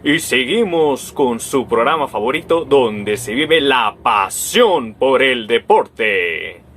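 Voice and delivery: loud, stretched-out sports announcer hype; sports show host. Retold or imitated. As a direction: sell it like a TV sports host, dragging out the end. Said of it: "en un programa deportivo alguien puede decir". (locutor deportivo entusiasta, alargando el final)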